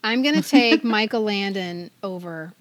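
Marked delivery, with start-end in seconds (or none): none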